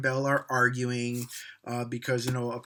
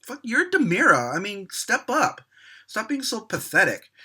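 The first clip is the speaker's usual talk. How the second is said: higher, louder frustrated telling-off; fed-up shout to snap out of it; exasperated rant; 8 semitones higher, 4 dB louder than usual. Retold or imitated. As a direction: yell at the screen at a moping character. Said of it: "I'm like".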